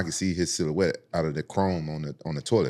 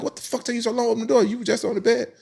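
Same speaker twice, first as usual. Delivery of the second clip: angry yell at door; 3 dB louder, faster than usual. Retold or imitated. Beating on the locked door.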